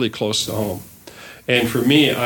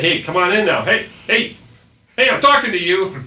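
put-on loud, high call to the kids; outside voice; 8 semitones higher, 4 dB louder than usual. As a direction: call the kids in from across the yard. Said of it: "that's my outside voice".

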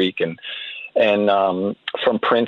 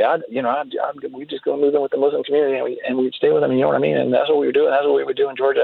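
imitating a fast-talking Southern man; quick Southern interviewee impression; fast Southern accent; 3 semitones higher, much faster than usual. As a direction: rattle it off in a mock Southern accent, running phrases together. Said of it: "he had this real Southern accent".

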